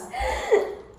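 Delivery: high giggle; high laugh. Real, not acted.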